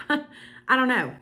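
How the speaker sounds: a sheepish, amused repeat; chuckling it out slowly; laughing. Real, not acted.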